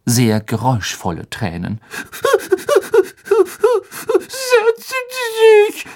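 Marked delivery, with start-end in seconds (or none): 1.9-4.1 very high wailing sob; loud elf wail. A narrator's reading. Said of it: "brach der Elf in Tränen aus". 4.2-5.8 "Setzen Sie sich" wailed very high and slow; wailing. A narrator's reading. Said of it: "jammerte er".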